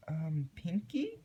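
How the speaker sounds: soft high hesitant pony voice; timid Twilight. A narrator's reading. Narrator voicing Twilight calling out to a silent Pinkie, high and timid. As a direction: ask gently, unsure what you'll find.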